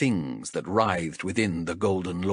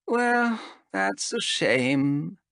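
slow, sighing regret; 11 semitones higher, much slower than usual. Acted. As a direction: let it out on a slow sigh. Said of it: "sighed Zenthi".

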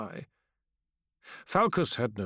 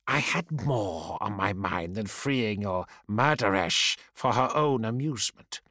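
weary restrained anger. Acted. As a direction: bite back polite fury. Ashokai glares, weary and angry.

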